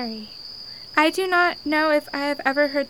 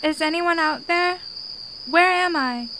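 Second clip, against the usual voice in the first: calling out. Acted. Lost girl calling out.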